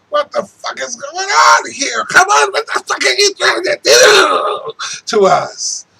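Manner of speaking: furious outcry climbing very high; angry shout. Real, not acted. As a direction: yell it at them in real anger.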